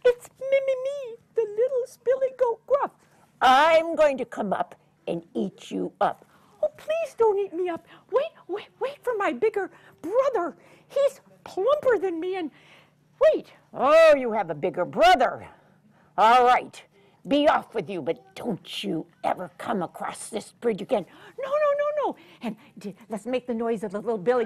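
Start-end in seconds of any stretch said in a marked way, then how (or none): 0.1-2.9 much higher, loud squeaky goat; little goat. Acted. 3.4-6.3 loud troll threat; troll threatens the little goat. Acted. 6.6-13.5 high loud pleading; little goat begs the troll. Acted. 13.7-21.1 loud gruff troll; troll lets the goat go. Acted. Troll relents. 21.4-22.2 much higher squeak; little goat. Acted.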